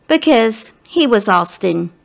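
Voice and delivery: plain reading voice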